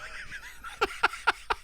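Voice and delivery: high, soft wheezing laugh; helpless breathy run of laughter; amused laugh. Real, not acted. Laughs at the mundane birthplace.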